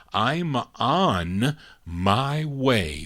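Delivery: slow, stretched song title; drawn-out title announcement. Real, not acted. DJ sends a requested record to a listener, drawn-out announcement. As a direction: draw the title out word by word.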